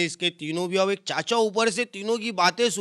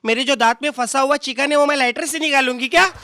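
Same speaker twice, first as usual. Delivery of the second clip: high, loud scornful comeback; mocking lady retort; 7 semitones higher, 7 dB louder than usual. Lady mocks him for giving a lighter, loud and high. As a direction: fire it back like an offended lady.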